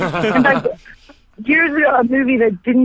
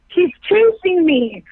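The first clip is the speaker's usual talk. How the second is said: frightened explanation; 8 semitones higher, slower than usual. Retold or imitated. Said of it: "I said".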